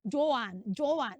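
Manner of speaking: "Joanne" in two syllables, jo-an; mispronounced name. Acted. Teacher mimicking people calling the name, mispronounced "Joanne". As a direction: call "Joanne" with an added "an" ending. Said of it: "use the right tone".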